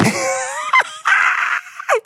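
high screaming laugh; shrieking laugh. Real, not acted. Vlogger reading a wild letter, bursting into a shrieking laugh.

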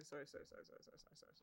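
sorry fading to a whisper; fading apology. Real, not acted. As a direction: trail off into a whisper.

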